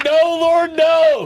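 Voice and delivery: very high, loud comic wail; over-the-top pastor begging God; mock pleading shout. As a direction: cry out to heaven in hammed-up protest.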